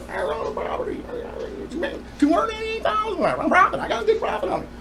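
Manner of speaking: thin, high-pitched mimicry of dad; mock gloating dad. Son imitating dad bragging about his property profit, mock gloating.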